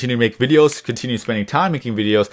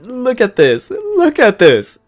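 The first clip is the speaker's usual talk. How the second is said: much higher and louder, slow triumphant show-off; proud boasting; 12 semitones higher, 4 dB louder, slower than usual. Pretend. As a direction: crow about imagined success. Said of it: "Then I could say".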